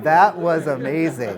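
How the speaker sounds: laughing loud at her own story. Real, not acted. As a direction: laugh through the words.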